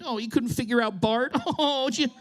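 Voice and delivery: mock their troubles with fake pity; mounting mock anger. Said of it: "I just feel myself getting angry".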